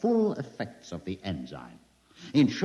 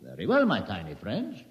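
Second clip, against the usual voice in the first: ordinary delivery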